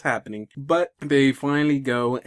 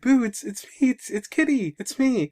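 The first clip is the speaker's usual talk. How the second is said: high, eager mock plea; acted pleading; 11 semitones higher than usual. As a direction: plead to be recognised by a child. Pleading to be recognised, retold.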